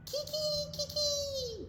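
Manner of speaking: high crowing rooster call. Acted. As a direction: crow it out like a rooster. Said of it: "crowed the rooster".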